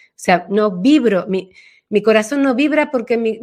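ordinary talk, nothing marked

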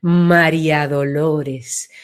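"María Dolores" drawn out slow and low, each vowel opened wide; María Dolores widening. Shown as a demonstration. Showing how the full name opens out, spoken slow and low. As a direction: stretch "María Dolores" slowly so the name feels broad. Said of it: "se ensancha".

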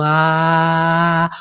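"ah" grave y sostenido; "Ah" desde el corazón. Shown as a demonstration. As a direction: dilo grave, largo, desde el pecho. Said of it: "una A realmente de corazón". ("Ah" grave y largo desde el corazón)

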